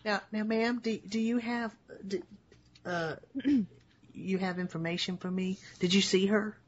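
resume a haltingly polite cop questioning a witness; questioning police officer. Acted.

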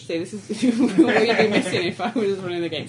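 laughing through the words; teasing chuckle mid-sentence. Real, not acted. Laughs while teasing a player.

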